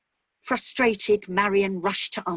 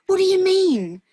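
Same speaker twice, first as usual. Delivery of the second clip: high, loud, stunned cry; a father hearing his daughter is missing, in shock; shocked disbelief; 12 semitones higher, 9 dB louder than usual. Acted. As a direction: blurt it out in horror.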